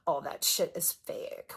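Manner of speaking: slow sighing whisper of disbelief; sigh, then a slow, hushed sneer; mock Midwest scoff. Mocking the Midwest mindset.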